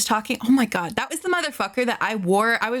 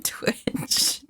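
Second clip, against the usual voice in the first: whispered; 5 dB quieter than usual. Real, not acted. Streamer to chat, whispering the end of a confession. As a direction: whisper the last word.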